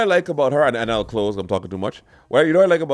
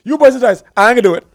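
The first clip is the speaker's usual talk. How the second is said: high, loud mock comeback; raised voice, playfully quoting a video; imitated viral clip; 9 semitones higher, 9 dB louder, much faster than usual. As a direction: act out the man from the clip. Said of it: "Your boy say".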